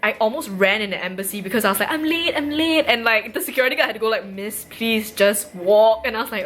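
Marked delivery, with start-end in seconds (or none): 1.9-2.8 higher-pitched, mock-panicked repetition; flustered hurry. Retelling her rush into the embassy, flustered higher voice. 4.2-6.1 drawn-out guard's request; slow, measured plea. Retold or imitated. Security guard to a running woman, slow measured plea.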